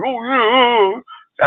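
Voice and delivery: high squeaky mock agreement; mocking yes-man. Streamer mocking a friend who agrees with everything. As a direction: squeak it like a brown-nosing sidekick. Mocking a laughing sidekick fan.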